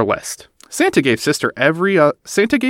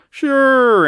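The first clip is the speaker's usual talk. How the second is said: breezy big brother; 12 semitones higher, 3 dB louder than usual. Acted. Brother answers.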